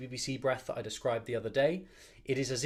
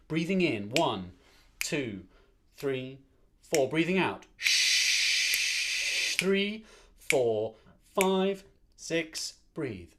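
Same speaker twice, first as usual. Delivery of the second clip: counting the breath; 2 semitones higher, much slower than usual. Shown as a demonstration. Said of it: "breathe in to a count of four".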